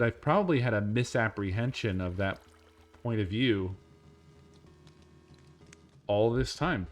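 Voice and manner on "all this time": ordinary talk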